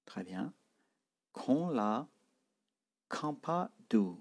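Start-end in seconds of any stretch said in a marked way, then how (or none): 1.3-4.2 Kong pitched high, kampa high and slowed; start high. Shown as a demonstration. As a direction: begin Kong high, then kampa slowly. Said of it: "on commence haut".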